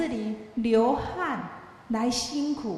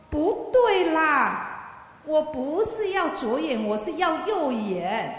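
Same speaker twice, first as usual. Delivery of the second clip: higher and louder, stretched out very slowly on 不对啦; a hammed-up whiny complaint about the wrong eye; complaining young man; 5 semitones higher, 3 dB louder, slower than usual. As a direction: drag out the objection like a fussy youth.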